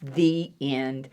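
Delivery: ordinary delivery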